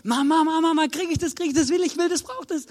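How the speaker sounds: put-on high whiny kid voice; begging child. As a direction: plead for the sweets like a small child. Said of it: "Und sagt".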